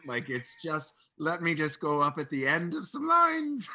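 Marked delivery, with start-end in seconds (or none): 1.2-3.7 loud, higher, swooping up at the end of "lines"; mock rising Irish lilt. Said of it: "such a general swath of Irish accent".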